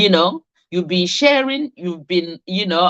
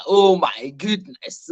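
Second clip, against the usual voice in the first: surprised; 4 semitones lower, slower than usual. Real, not acted. Delighted by viewers joining.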